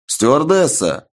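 high, slow calling out; shouting. A narrator's reading. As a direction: call out across the cabin. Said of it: "громко крикнул Сергей".

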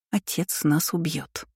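soft, drawn-out moan. A narrator's reading. Mila dreading her father's punishment, soft moan. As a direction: moan it slowly, very softly. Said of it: "простонала Мила".

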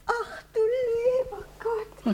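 slow, high, tearful wail; slow high lament, near tears; dismayed wail. Acted. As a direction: drag it out like a fretful old lady. Housekeeper wails in dismay.

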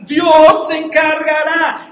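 loud, high, slow declaration of faith; ringing preacher's shout, words stretched out; emphatic proclamation. Acted. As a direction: proclaim it slowly at full voice. Repeats Abraham's answer forcefully.

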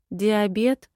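ordinary delivery, nothing marked

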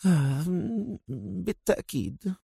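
very soft sleepy murmur; drowsy, barely-there agreement; yawning mumble. A narrator's reading. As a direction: mumble through a yawn. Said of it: "تثاءبت مرة أخرى مغمغمة".

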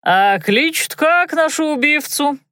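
overfamiliar, cheeky question. A narrator's reading. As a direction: ask it with a swagger. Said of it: "спросил с излишней развязностью".

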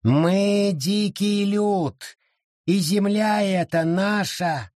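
high, slow, proud voice of the forest; lofty creature voice; wild forest spirit. A narrator's reading. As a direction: announce it like a wild creature claiming its land. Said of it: "объявил лес".

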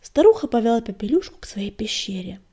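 plain reading voice

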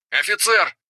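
high shout across the site; calling out. Acted. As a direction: call out to get attention. Archaeologist calls out.

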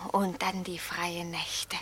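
soft, slow whisper; hushed whisper. Acted. Tiger begins its night memories, slow whisper. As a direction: breathe it out slowly in a whisper. New voice breaks in unvoiced.

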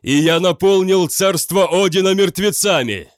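loud, resonant declaration with echo; booming proclamation. A narrator's reading. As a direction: boom it out like a herald of the dead. Hero claims to be a dead warrior.

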